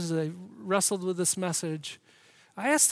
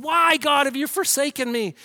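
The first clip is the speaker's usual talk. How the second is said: loud, high cry of forsakenness; 5 semitones higher, 9 dB louder than usual. Acted. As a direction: cry up to God from the cross. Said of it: "he said".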